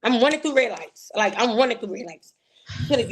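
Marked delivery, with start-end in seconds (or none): none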